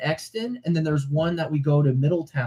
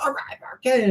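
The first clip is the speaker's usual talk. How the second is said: excited; 12 semitones higher than usual. Retold or imitated. Excited kid voice in retold story.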